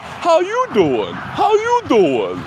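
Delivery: high, slow sing-song host greeting; mock talk show host. Mocking the daytime show host's greeting, high and slow. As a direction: drag it out like a gushing host. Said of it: "the one chick is like".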